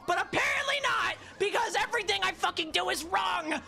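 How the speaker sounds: fed up with the controls, high furious shout. Real, not acted. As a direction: yell it out in real frustration. Raging at the controls.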